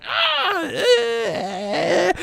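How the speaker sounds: a mock strangled cry from behind. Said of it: "an earthly chorus of".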